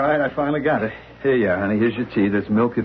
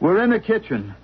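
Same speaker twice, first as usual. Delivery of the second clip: raised high, calling through the house; 11 semitones higher than usual. Acted. Husband calls from the kitchen to the arriving neighbour, high call. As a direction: pitch it up to reach the next room.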